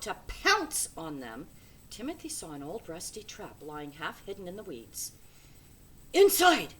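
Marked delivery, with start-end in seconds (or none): 6.1-6.7 loud urgent shout. A narrator's reading. Timothy shouts to Spinny to hide, a loud urgent shout. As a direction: bark the order to dive in. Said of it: "he shouted".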